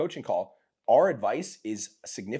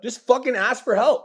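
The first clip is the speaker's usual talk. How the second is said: drawn-out yell, high and loud; slow shout begging a student to ask for help; 13 semitones higher, 10 dB louder than usual. Acted. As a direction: yell the plea slowly. Yelling at imagined student.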